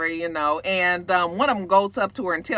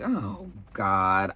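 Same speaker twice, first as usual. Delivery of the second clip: low, weary mock dread; low-pitched resigned sigh of words; exasperated groan; 4 dB quieter than usual. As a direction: sink low, fed up. Her fed-up reaction to the couple.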